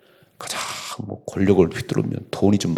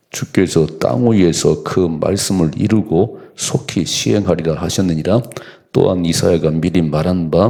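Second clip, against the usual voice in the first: louder, measured pulpit reading; scripture reading; 2 semitones lower, 5 dB louder than usual. Acted. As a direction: proclaim the verse to the congregation. Reads scripture aloud.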